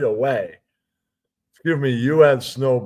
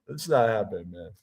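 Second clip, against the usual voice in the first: ordinary talk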